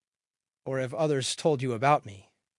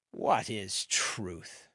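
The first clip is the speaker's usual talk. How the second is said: lower, softer, drawn-out question; slow pondering question; 5 semitones lower, 5 dB quieter, much slower than usual. A narrator's reading. Pilate replying to Jesus about truth, slow and low. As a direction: stretch each word out, low and slow.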